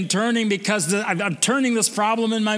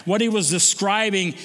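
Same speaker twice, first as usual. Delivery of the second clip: ordinary delivery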